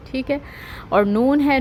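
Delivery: ordinary delivery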